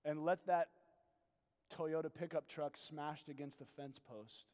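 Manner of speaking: soft, low pastoral voice drawing the listener in; hushed sermon voice asking the congregation; intimate preaching. Acted.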